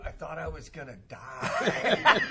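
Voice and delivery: exaggerated retold cry of suffering; comic mock desperation. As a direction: stretch it out in hammed-up agony, near laughter. Retells friend's sweat lodge ordeal.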